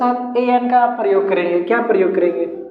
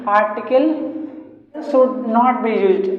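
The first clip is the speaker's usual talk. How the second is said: slow dictation; much slower than usual. Shown as a demonstration. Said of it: "इसको English में लिख देते हैं कि".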